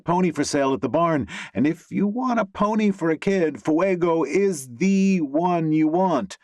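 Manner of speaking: high, gushing horsey woman; high excited voice. A narrator's reading. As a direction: gush about the pony, excited. Eager pony lady.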